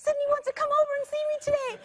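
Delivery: high, loud, breakneck excitement; so excited. Retold or imitated. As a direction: burst out with thrilling news for your sister. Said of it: "he was just so excited".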